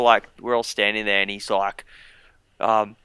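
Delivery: usual speaking voice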